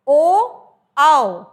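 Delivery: ओ and आओ called out loudly; loud vowel demo. Shown as a demonstration. Teacher demonstrating the vowels to students, loud. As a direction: shout ओ, आओ clearly to the class. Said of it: "फिर हमने बोला".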